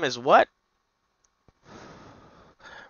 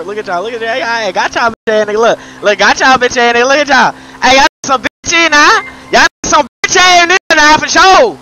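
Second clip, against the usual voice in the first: building from teasing to a high, loud jeering shout; triumphant trash-talk rising to a yell; taunting yell; 6 semitones higher, 7 dB louder than usual. Real, not acted. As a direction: crow over them louder and louder.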